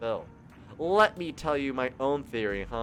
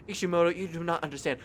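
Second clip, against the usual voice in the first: ordinary talk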